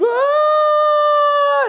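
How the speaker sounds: long, loud, high-pitched wail; mock wail. Professional mourners at the dead girl's house, mock wailing. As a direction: wail loudly like a hired mourner.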